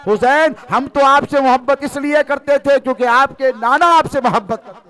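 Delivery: loud, high pleading sermon; impassioned plea. Real, not acted. Preacher voicing sinners' plea to Husain, fervent.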